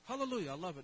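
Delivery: ordinary talk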